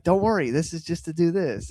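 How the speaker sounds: high, gentle reassurance; sweet alien voice. Retold or imitated. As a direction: soothe her in a lifted voice.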